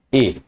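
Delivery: "a" as a clear "ay"; acute-accent E sound. Shown as a demonstration. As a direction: say "a" as French é. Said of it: "when you put this accent to it".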